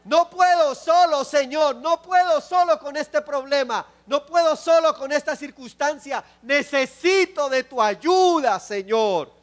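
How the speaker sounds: a believer begging the Lord, loud and earnest; pleading prayer. Acted. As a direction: cry out to the Lord for help. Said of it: "Es de decirle al Señor".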